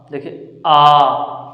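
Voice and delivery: आ drawn out slowly from the throat; long throaty आ for students; slow demo aa. Shown as a demonstration. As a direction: stretch the आ like it's stuck in the throat. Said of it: "ऐसा लग रहा है जैसे यहाँ गले में अटका हुआ है".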